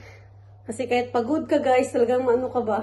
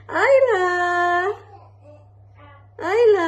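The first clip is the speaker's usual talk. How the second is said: high, loud, stretched-out name; loud lilting mommy call; sing-song calling; 8 semitones higher, 7 dB louder, slower than usual. Real, not acted. Mother calls to sleeping baby.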